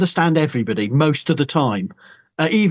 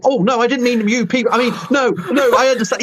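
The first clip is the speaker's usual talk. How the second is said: flustered apology; 8 semitones higher than usual. Retold or imitated. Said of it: "I go".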